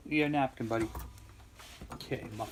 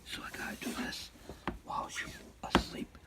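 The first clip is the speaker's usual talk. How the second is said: whispering; quiet at night; 13 dB quieter than usual. Real, not acted. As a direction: keep it to a whisper. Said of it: "Wife is sleeping".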